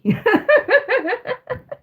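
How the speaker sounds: high giggling burst; high laugh. Real, not acted. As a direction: giggle helplessly. Laughing at Boris story.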